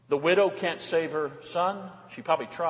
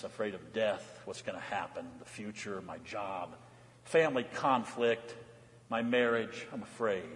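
much softer, confiding to the congregation; hushed, intimate; 8 semitones lower, 10 dB quieter than usual. Real, not acted. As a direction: lean in and speak it gently to each listener. Pastor confides about everyone's fears.

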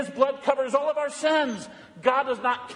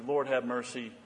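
low, hushed cry for mercy; soft plea for mercy from the congregation; quiet liturgical plea; 11 semitones lower, 9 dB quieter than usual. Acted. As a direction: pray it softly like the liturgy. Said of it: "we cry out as we do in our liturgy".

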